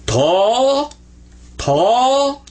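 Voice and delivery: "Tall, tall" as a heavy, dark T with the L swallowed; emphatic ṭa drill. Shown as a demonstration. Teacher drilling students on the emphatic letter, said heavy. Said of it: "Don't say the L on it".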